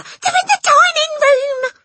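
very high, loud frightened squeal; panicked hiss. A narrator's reading. Mother warns the family, shrill panic. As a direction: shriek the warning urgently. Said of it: "hissed the mother".

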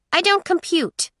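high, puzzled android; confused robot voice. Acted. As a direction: say it like a baffled little robot.

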